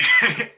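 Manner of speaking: sudden squeaky laugh; a high, loud giggle; real laugh breaking out; high burst of laughter. Real, not acted. Laughing at own story.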